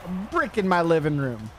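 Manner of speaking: put-on a cranky Southern homeowner yelling about vandals, in a Southern accent; grumpy Southern old man. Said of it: "you're doing a Southern accent".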